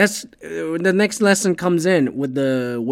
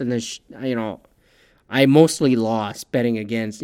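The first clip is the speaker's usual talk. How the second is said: ordinary talk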